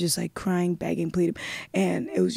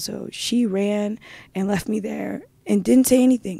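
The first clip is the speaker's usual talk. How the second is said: usual speaking voice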